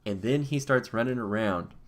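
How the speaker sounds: usual speaking voice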